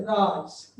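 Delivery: "राइस" slowly, with a long eye vowel; long vowel demo. Shown as a demonstration. Teacher giving an example of the rule, "राइस" with a lengthened vowel. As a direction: draw out the middle of "राइस". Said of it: "इस तरह से हम उसका उच्चारण करते हैं".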